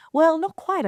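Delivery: high, loud forced brightness; telling producer, high and strained; strained reply. Retold or imitated. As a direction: push it up bravely.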